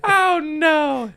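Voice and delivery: high shrieking laugh. Real, not acted. Vlogger laughing hard at his own rant, high shriek.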